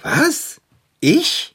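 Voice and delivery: high-pitched squawk of disbelief; astonished. Acted. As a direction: react stunned, pitch leaping up. Shocked at being called odd.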